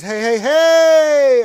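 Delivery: high, loud, drawn-out hey; big rowdy greeting; exuberant shout. Acted. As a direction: holler it, stretching the last word. Reads chat greeting as shout.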